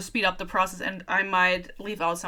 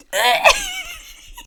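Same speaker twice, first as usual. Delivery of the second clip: high burst of real laughter; shrieking laugh; 7 dB louder than usual. Real, not acted. Reader laughing at the book, a high shriek. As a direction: crack up at the cringe line.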